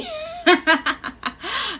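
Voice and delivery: high burst of laughter; real laugh. Real, not acted. Streamer caught mistaking a viewer's gender, high laugh.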